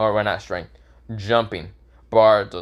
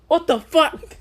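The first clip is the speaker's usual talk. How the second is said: high squealing shock; shocked girls; slower than usual. Acted. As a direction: squeal it in disbelief. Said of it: "All the girls go".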